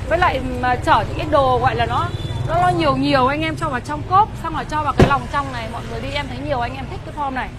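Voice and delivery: normal talking voice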